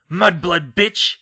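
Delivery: slow, loud snarling insult; angry yell. Acted. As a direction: spit the insult out. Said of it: "he yelled".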